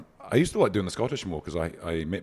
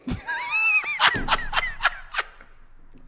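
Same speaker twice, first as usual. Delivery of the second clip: real high, drawn-out cackle; high-pitched laugh. Real, not acted. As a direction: squeal with laughter, long and high. Cackling at an awkward joke.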